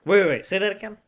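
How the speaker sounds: surprised. Real, not acted. Startled interruption of other speaker.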